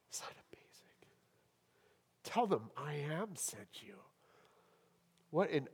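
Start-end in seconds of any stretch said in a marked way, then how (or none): 0.1-1.0 hushed whisper of wonder; awed whisper. Real, not acted. Preacher drops to hushed awe. 2.2-3.9 "Tell them I am sent you" whispered, softer, in awe. Shown as a demonstration.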